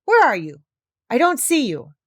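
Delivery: furious king searching the dark; angry king. Acted.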